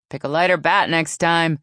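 loud heckle across the field; yell. A narrator's reading. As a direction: yell it at the batter. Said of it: "she heard herself yell".

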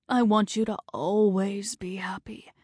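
breathless whisper of a dying man. Acted. Dying husband whispering his wish to his wife.

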